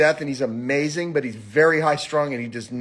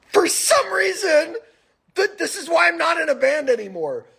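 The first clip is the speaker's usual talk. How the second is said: laughing exasperation; 13 semitones higher than usual. Real, not acted. Exasperated rant about the drummer.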